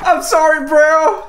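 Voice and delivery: high, loud, teasing sorry; fake-sorry taunt over the body; mock apology. Gamer taunts the player he just killed, high mock sorry.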